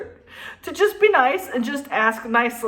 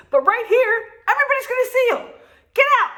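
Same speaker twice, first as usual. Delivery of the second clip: climbing much higher and louder into a yell; mock furious outburst; 11 semitones higher, 4 dB louder, much faster than usual. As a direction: blow up at the girls to drive them off. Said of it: "Well, I didn't say it like that".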